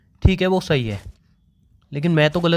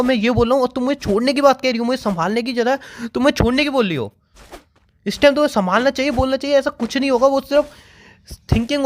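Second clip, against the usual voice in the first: upset arguing; 7 semitones higher than usual. Real, not acted. Upset boyfriend pleading and arguing.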